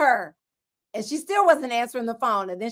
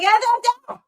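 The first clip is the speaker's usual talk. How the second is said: higher, put-on voice mocking her friend; teasing high imitation of a flustered friend; 10 semitones higher, 5 dB louder than usual. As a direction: pitch it up and parody her. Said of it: "I don't sound like that".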